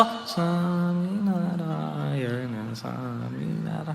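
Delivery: chorus sinking almost into silence, very slow. Retold or imitated. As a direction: mumble the chant down into the ground. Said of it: "chorus તો એનથી પણ અંદર જતું રહ્યું હતું ગ્રાઉન્ડમાં". (એનાથી પણ ધીમો, દબાયેલો કોરસ)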